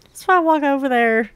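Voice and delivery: normal talking voice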